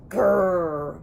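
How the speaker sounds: low grumbling growl; sleepy T. rex growling goodnight; dinosaur grumble. A narrator's reading. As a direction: growl it low like a drowsy beast. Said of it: "grumbled the big dinosaur".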